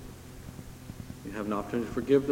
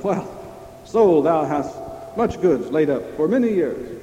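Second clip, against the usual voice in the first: louder, higher smug boast; the rich fool; 5 semitones higher, 6 dB louder than usual. Retold or imitated. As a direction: gloat over your full barns. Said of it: "the rich fool said".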